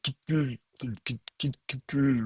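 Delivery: "kitul" with its vowel dropped, stumbling on the consonants; unpronounceable cluster. Shown as a demonstration. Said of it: "si je fais tomber la voyelle, je me retrouve avec".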